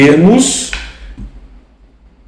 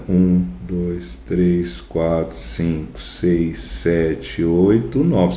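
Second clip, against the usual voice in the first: counting syllables; 6 semitones lower, 10 dB quieter, much slower than usual. Shown as a demonstration. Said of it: "olha o que que eu tô fazendo".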